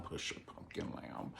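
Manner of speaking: breathy whispered taunt; sly whisper. Acted. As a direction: whisper the pet name like a threat. Sly aside to a rival.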